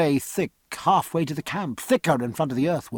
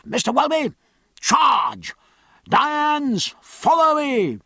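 calling out; 10 semitones higher, slower than usual. A narrator's reading. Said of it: "He called out".

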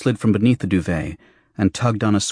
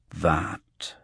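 low, soft, weighted single word; slow, deliberate; 3 semitones lower, 6 dB quieter than usual. A narrator's reading. Petal objecting to Sally taking the girl, slowly. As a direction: set the word down slowly and quietly.

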